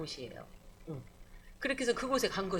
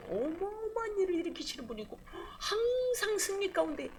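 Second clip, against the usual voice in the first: tearful praise; 9 semitones higher, 3 dB quieter than usual. Real, not acted. Fervent praise of God.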